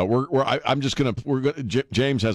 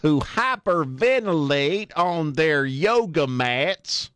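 higher, slow, relishing mock drawl of the quote; savoring echo; 9 semitones higher, slower than usual. Host relishing senator's yoga-mat jab, slow mock echo. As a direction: roll the line out slowly, delighted.

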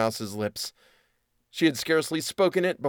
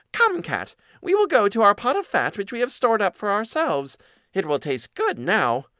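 high squeaky little mouse; small eager mouse, pitched way up; 9 semitones higher than usual. A narrator's reading. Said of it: "the mouse thought of their stored food and said".

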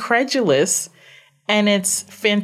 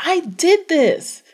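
slow, high, stunned; drawn out in wonder; amazed disbelief; 10 semitones higher, slower than usual. Retold or imitated. Retelling her own amazed reaction.

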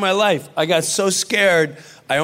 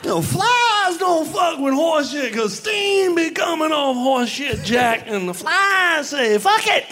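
high, shouting Pryor-style rant; Richard Pryor impression; 9 semitones higher than usual. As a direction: belt it out high like Richard Pryor doing a bit. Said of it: "we did Richard Pryor for an hour".

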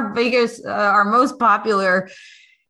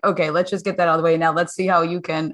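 quick brushing-off; 5 semitones lower, faster than usual. Retold or imitated. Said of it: "it was like".

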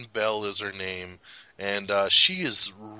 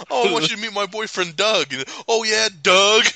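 mock girly intro; 10 semitones higher, 5 dB louder than usual. Imitating Maya introducing boyfriend.

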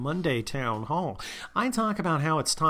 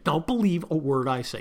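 usual speaking voice

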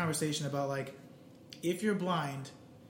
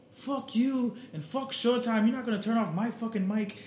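high, loose Irish-accent impression; Conor McGregor; 6 semitones higher than usual. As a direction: imitate a cocky Irish trash talker. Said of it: "his accent".

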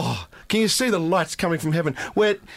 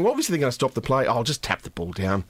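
resigned player; 9 semitones lower, 3 dB quieter than usual. Retold or imitated. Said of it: "the player gives himself up going".

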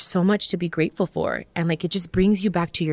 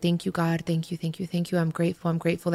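softer, murmured thank-yous; soft gratitude mantra; 7 dB quieter than usual. Shown as a demonstration. Showing listeners her meditation mantra, softly murmured. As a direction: recite it like a quiet prayer in your head.

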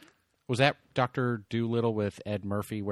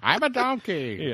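cartoon donkey voice; 9 semitones higher, slower than usual. Donkey impression.

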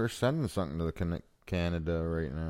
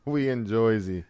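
mock Jersey accent, a touch higher; New Jersey accent; 4 semitones higher, 3 dB louder than usual. Cohost riffing in a Jersey accent. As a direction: toss it off like a Jersey tough guy.

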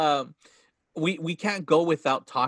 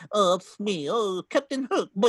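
high, bumbling cartoon sidekick voice; put-on dithering character voice; Smee; 5 semitones higher than usual. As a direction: do a flustered cartoon henchman. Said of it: "he sounds like that".

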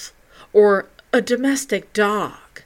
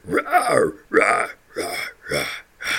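deep fading beast roars; echoing growl; 12 semitones lower than usual. Acted. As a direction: roar like it echoes away. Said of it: "the growl echoed throughout the fields".

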